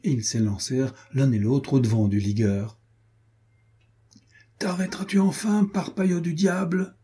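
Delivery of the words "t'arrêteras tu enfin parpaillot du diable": high-pitched cry without much added volume; narrated shout, pitched well up; breathless mock shout. A narrator's reading. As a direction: call after a runaway friend, exasperated. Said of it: "cria Renaud hors d'haleine".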